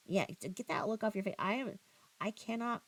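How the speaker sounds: ordinary delivery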